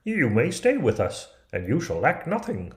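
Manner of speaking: a small dwarf setting out the terms; dwarfs' offer. Acted.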